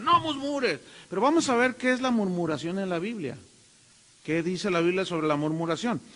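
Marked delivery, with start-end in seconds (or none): 0.0-0.8 high, scolding "No murmures"; mock scolding. To critical christians, a high mock scold. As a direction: imitate a believer hushing you. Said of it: "te quieren parar".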